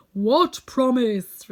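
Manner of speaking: loud, very slow, solemn question. A narrator's reading. Unseen wizard questions the visitors, loud and solemn. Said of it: "requested Oz".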